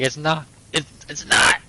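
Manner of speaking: insistent conspiracy nut; conspiracy theorist voice. Retold or imitated. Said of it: "his fucking conspiracy theorist voice".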